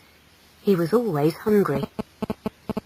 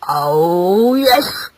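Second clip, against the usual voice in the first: drawn-out growling confirmation; slow, menacing growl. Acted. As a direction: growl it like a grim father. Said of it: "growled her husband".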